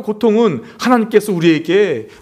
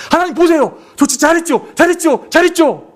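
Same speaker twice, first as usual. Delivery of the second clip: put-on childish bragging; 11 semitones higher, 3 dB louder than usual. Preacher voices himself as child.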